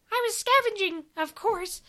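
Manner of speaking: high squeaky creature voice. Acted. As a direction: snap it out like a startled little creature. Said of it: "the creature said".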